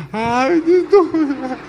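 high, squeaky yelp; mock shock at price. Broke man hearing translation costs ten dollars, high mock dismay.

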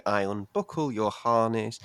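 ordinary talk

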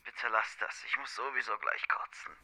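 hungover groan. Acted. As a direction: barely get the words out, nauseous. Verkaterter Kollege am Telefon. (verkatert und leise, kurz vorm Übergeben)

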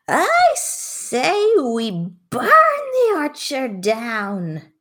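high, drawn-out rallying yell; battle yell. Acted. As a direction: yell it out long and high, like calling a mob to arms. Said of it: "jumps down from a tree and yells".

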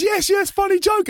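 very high, teasing prankster voice; Flo. Retold or imitated. Prankster unbothered by singer's outrage, very high gleeful voice. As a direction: imitate a gleeful friend in a squeaky pitch. Voicing Flo's gleeful answer.

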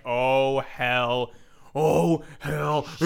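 slow, drawn-out hell yeah like a wrestler; lightly voiced wrestler cheer; Stone Cold impression. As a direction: growl it out like a pro wrestling star. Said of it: "More Stone Cold".

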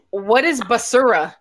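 put-on Spanish word said with a clumsy American accent; botched Spanish pronunciation. Supervisor puzzled by a sign, mangled Spanish in English accent. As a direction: imitate an Anglo boss mangling Spanish. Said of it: "he could not say it".

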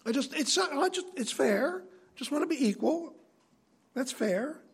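high, petulant demand for equality; whiny rebel. Acted. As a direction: whine for fairness like a sulky child. Korah voiced wanting equality.

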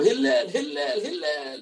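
high, insistent mock call; calling out for Hillel. Man banging on the rabbi's door, high mock calling. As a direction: call the name repeatedly in a high singsong. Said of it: "bangs on the door".

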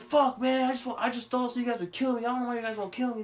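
high, rapid imitation of an upset man; mock angry whining. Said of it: "he's all fucking mad".

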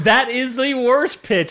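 high, slow, laughing verdict. Real, not acted. Co-host judging the bad film pitch, laughing. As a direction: drag it out through real laughter.

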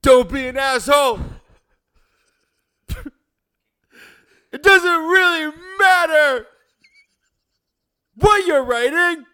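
hammy stage actor; big dramatic delivery, loud and high; theatrical monologue voice. Pretend. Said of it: "read this in your best monologue voice, the most theatrical voice".

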